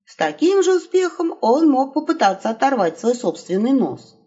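usual speaking voice

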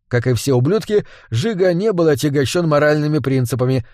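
ordinary delivery

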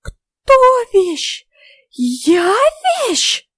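very high, outraged fairy voice; affronted tiny fairy; trembling with indignation. A narrator's reading. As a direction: squeal each question in mounting indignation. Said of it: "задрожал от рвущегося наружу негодования".

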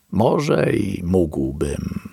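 usual speaking voice

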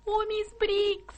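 high, slow, drawn-out call; a plaintive slow cry for Miss Briggs; anxious high call. Acted. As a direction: call out to her, worried and stretched. Maid calling anxiously.

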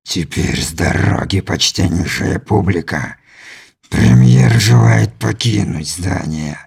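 low, slow mocking theatrical threat; menacing alien. A narrator's reading. Alien voiced by narrator. (низкий медленный насмешливый голос)

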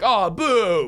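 a jeering arena crowd, booing; mock crowd boo.

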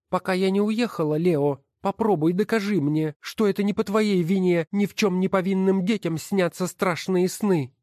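high, scornful woman's voice; wife's voice. Acted. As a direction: sneer at each title as you sort them. Wife challenges her husband. (высокий насмешливый голос жены)

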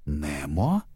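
low, uncertain rising call; questioning exclamation. A narrator's reading. Marlin peers into murky water, calling in low disbelief. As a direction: call into the murk, unsure who is there. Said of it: "воскликнул Марлин".

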